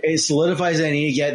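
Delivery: usual speaking voice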